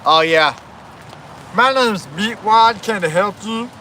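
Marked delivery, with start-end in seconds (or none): 1.5-3.7 higher, slow cartoon character voice; Meatwad. Offering help as a cartoon character, slow high impression. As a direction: slowly introduce yourself in a put-on cartoon voice. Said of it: "I can do his cry".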